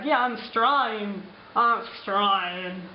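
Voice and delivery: put-on slow, drawn-out Aussie accent; broad Australian. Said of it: "it's like".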